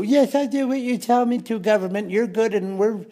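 high falsetto parody of meek compliance; mock obedient citizen. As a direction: squeak it out like a sheepish yes-man. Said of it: "where they just go".

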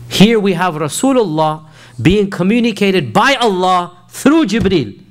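impassioned preaching. Acted. Preaching with rising fervour.

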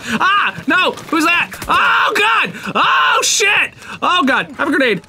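high, alarmed yelling; frantic and high-pitched; panicked shouting. Real, not acted. Surprised by enemy and grenade.

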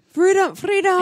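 loud, high Scottish cry; hammed-up Scottish battle cry; Scottish accent. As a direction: bellow it in a mock Scottish accent. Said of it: "I can always do Scottish".

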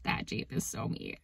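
very soft, low whisper; awed whisper. Real, not acted. Telling viewers the Jeep suits her, awed whisper. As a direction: drop right down to a hushed whisper.

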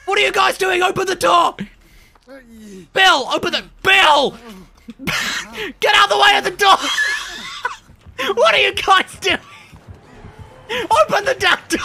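frantic loud yelling; shouting. Real, not acted. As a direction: yell at teammates to open up. Yelling at teammates to open door.

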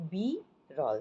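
বি, pause, রল; syllable by syllable. Shown as a demonstration. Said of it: "একবারে বলছি না".

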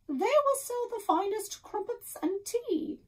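hammed-up fake British accent, pitched high; a deliberately bad British accent for a joke. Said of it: "She faked a very bad British accent".

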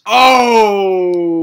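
loud high fake sob; hammed-up wail at the sad ending; mock crying wail. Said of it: "mock sob".

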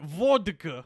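put-on Russian voice; Russian accent. Shown as a demonstration. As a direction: work into a mock Russian accent. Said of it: "Let me see if I can get into it".

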